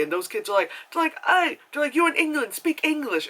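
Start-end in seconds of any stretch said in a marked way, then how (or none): 1.3-1.6 high, loud shout-out; mock hostile call. Kids calling after the upset lady, jeering shout. 1.9-3.3 high, jeering put-on voice; mock heckling. Kids telling off an immigrant lady, mock jeering.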